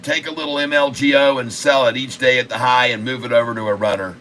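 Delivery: ordinary delivery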